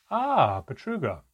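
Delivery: high, loud startled exclamation; nervous greeting. A narrator's reading. Elf guard greeting feared Betruga, nervous. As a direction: blurt it out, caught off guard. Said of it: "their voice suddenly nervous".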